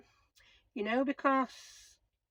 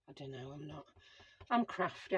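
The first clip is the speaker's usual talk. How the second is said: usual speaking voice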